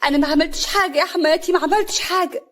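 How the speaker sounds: high, protesting plea; tearfully indignant denial; angry and tearful. A narrator's reading. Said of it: "هنا بغضب ودموع".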